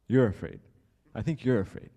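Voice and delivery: very loud, punchy acted retort; sarcastic comeback. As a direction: turn it back on him, loud and mocking. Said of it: "he's extremely sarcastic".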